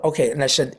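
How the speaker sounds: ordinary delivery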